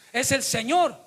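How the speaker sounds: high, loud preaching at full force; impassioned pulpit warning; fiery preaching. Acted. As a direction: preach it out with fire to the congregation.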